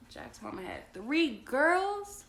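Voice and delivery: high, loud, drawn-out disbelief; astonished. Real, not acted. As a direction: stretch it out in amazed disbelief. Reader reacts to the triplets.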